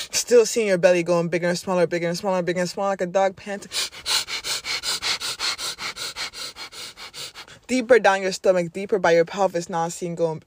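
energetic breathing coach. Acted. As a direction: push them through the exercise.

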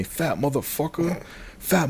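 high yell with the volume held back; half-voiced heckler's shout; mock yelling. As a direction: imitate a man yelling insults from a distance. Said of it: "He kept yelling at me".

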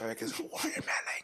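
mock ASMR whisper. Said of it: "them A S M Rs".

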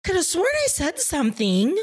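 high, feigned innocent voice; a lightly voiced clueless friend faking surprise; innocent Casey. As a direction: play dumb like you honestly forgot. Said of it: "Casey fully just gaslit us and was like".

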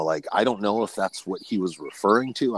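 ordinary talk

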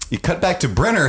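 ordinary talk, nothing marked

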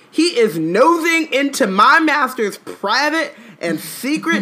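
high, loud, huffy elf voice; mock-indignant elf. Reading Winky's drunk outburst at Harry, high and loud. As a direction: puff up and scold like an offended elf. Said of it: "said Dobby angrily".

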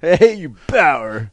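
slow, loud taunting "Bauer"; mock insult. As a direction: call out "Hey, you Bauer" like a playground insult. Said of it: "That's easy enough to say".

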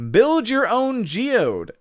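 slow, high ad pitch; hammed-up advertising voice; mock ad slogan. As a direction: sell it like a toy commercial. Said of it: "It'll be".

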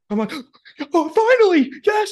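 gasp, then high joyful cry; excited. Retold or imitated. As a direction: relive the thrill of the pick. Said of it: "And I'm like".

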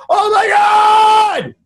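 long, very high drawn-out yell; a first-time tripper panicking as strangers swing him, screaming; panicked scream. Retold or imitated. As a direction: stretch it into a high terrified shout. Tripping, swung around by strangers.

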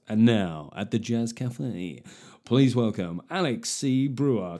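slow, smooth club announcer voice; slow hushed compere at the Jazz Cafe; jazz club host. Pretend. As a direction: introduce the act at the Jazz Cafe. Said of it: "sound like a jazz guitarist".

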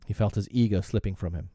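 ordinary delivery

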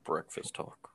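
unvoiced whispered echo. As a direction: breathe the show name. Echoing show name in whisper.

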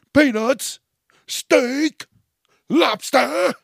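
high, loud ballpark hawker cries; mock peanut vendor; vendor calls. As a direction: shout the menu like a stadium seller. Imitating stadium vendor calls.

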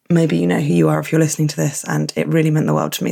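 normal talking voice

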